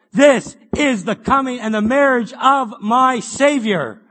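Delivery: slow, loud, emphatic declaration; solemn proclamation. Acted. Preacher voicing believers explaining the feast, slow and loud. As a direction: lay out each word slowly like a creed.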